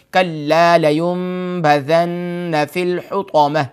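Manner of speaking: slow, melodic tajweed recitation; Quran recitation. Shown as a demonstration. Teacher reciting the verse for his viewers, slow and chanted. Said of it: "آیت number چار ہے".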